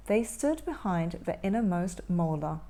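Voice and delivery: ordinary delivery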